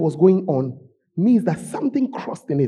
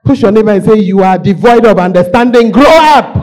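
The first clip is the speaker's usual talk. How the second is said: loud preaching charge; preacher's loud call to the church; loud command; 10 dB louder than usual. Real, not acted. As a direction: rouse the room with it.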